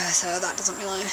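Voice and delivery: normal talking voice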